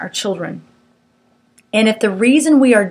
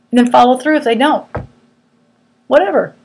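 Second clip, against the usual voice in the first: ordinary talk, nothing marked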